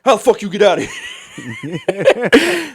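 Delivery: high, slow, laughing through the words; laughing panic. Retold or imitated. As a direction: squeal it while laughing. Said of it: "he's like".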